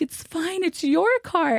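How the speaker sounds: high-pitched, mock-weepy explanation; retold tearful reassurance. Wife retelling a crying call to husband, mock-tearful. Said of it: "when I'm crying".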